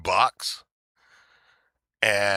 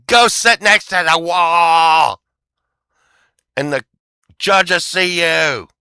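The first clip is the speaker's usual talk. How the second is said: louder and higher, slow barked order; mock scream; 7 semitones higher, 10 dB louder, slower than usual. Retelling her ordering him to the wall, loud. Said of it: "she screams at me".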